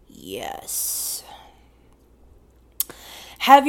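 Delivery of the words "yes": breathy secret yes; whispering. Real, not acted. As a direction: admit it in a whisper. Whispered confession.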